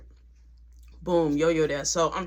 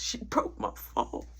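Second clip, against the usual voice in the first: stunned murmur; 11 dB quieter, slower than usual. Retold or imitated. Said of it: "going through so many emotions".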